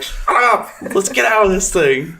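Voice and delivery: high-pitched retold yell; mock exasperated yell. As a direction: act out yelling at a panicky friend. Retelling own yell at screaming buddy.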